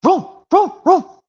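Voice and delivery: very high, loud yappy barks; dog barking impression. Puppy barking at home, high yappy barks. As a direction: bark like a small excited dog. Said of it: "how about this one where it goes".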